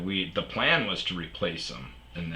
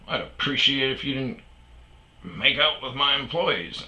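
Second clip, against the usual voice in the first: raised, louder mock-gruff complaint; gruff manager; 6 semitones higher, 4 dB louder than usual. Manager scolding a man about his employees, mock-gruff. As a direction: imitate a gruff boss telling someone off. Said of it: "he's always really gruff".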